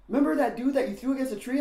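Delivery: high, loud and fast; imitating excited friend. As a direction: rush the news out. Friend's excited phone news.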